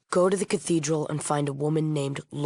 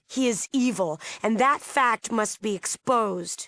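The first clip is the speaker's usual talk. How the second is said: higher, slowing, emphatic condemnation; vehement denunciation; 5 semitones higher than usual. Acted. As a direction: denounce an enemy with conviction. Denouncing the cult's leader.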